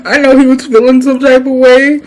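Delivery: laughing while telling the story; words broken by giggles. Real, not acted. Laughing at her own story.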